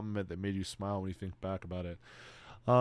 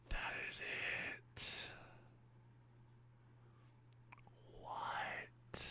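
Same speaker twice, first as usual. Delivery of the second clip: gasped, awed whisper; stunned whisper; 15 dB quieter, much slower than usual. Real, not acted. Streamer whispers in awe at the found video. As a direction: breathe it out in disbelief.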